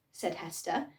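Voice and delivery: plain reading voice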